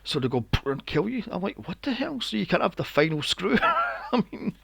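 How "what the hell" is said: mock-baffled outburst, pitched well up; incredulous reaction. Acted incredulous reaction to film rule.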